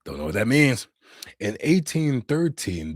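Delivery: ordinary talk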